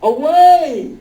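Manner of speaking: loud high yell; shouted away. Acted. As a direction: shout him off. Player shouting at the brute.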